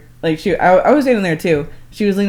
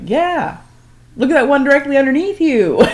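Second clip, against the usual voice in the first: high, louder teasing warning; mock warning; 9 semitones higher, 5 dB louder than usual. Husband warning sister-in-law about gator below, high teasing. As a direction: sing out the danger playfully. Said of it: "And then you're like".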